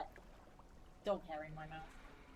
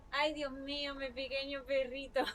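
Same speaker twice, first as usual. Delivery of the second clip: Spanish endearment; 5 semitones higher, 4 dB louder than usual. Real, not acted. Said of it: "Good Spanish".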